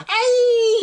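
loud, very high imitated girly squeal; mock high-pitched squeal. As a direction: mimic an anime girl's piercing squeal. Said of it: "Japanese girls and high-pitched voices".

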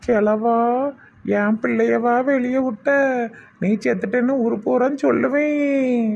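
put-on high, drawn-out old woman's voice; railing old woman. Old woman scolds the dead man over her child, high railing. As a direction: rail at him in a high voice.

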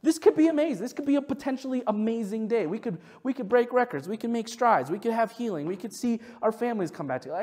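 high, bright-eyed eager optimism; giddy hopeful self-talk; excited hopeful. Pretend. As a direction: gush like a wide-eyed kid. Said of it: "I just wanna go in every day just going like".

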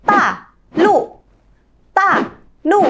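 第四声 高起急降; sharp fourth tones. Shown as a demonstration. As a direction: start each syllable high then cut it off hard. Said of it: "Cô Trang lấy ví dụ nhá".

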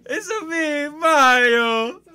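shouted high cartoon Italian; hammed-up Italian accent at full volume; squealing Italian caricature; Mario. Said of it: "How do you do an Italian voice?".

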